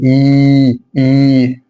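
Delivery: إي held long and level, "eeee"; long vowel. Shown as a demonstration. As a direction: stretch each إي out slowly. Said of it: "أطول الياء". (إي ممدودة طويلة)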